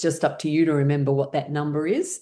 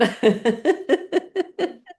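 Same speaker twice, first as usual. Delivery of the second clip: high, rippling laughter; amused laugh; 11 semitones higher than usual. Real, not acted. Teacher suggests doing it at work alone, high laugh. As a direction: laugh at your own suggestion.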